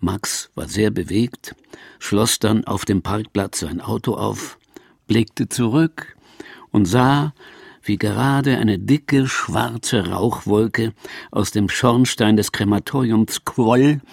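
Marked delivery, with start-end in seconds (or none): none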